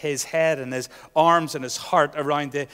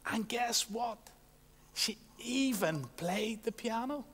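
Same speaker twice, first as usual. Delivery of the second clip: gossipy whisper; 5 semitones higher, 10 dB quieter than usual. Retold or imitated. Mock gossip on the phone.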